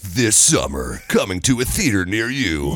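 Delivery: slow, dramatic trailer announcer; movie trailer voice. Shown as a demonstration. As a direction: sell a blockbuster, slowly. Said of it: "the trailer voice".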